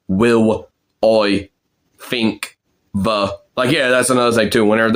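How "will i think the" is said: "Will I think the" as "wiw oy fink va"; word by word "Will I think the" with f and v for th; Cockney pronunciation. Shown as a demonstration. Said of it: "So it goes like".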